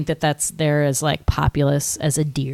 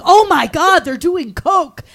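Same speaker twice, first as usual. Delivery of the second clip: mock shock; 11 semitones higher, 7 dB louder, slower than usual. Shocked at seeing people do coke.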